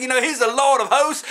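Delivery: ordinary talk, nothing marked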